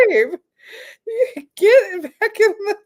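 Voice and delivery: high, laughing plea to get back inside; laughing too hard to finish telling him to go back in. Retold or imitated. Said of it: "And I was like".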